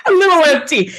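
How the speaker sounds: high, loud laughing repeat; laughing echo. Real, not acted. Daughter echoing her dad's line, laughing loudly.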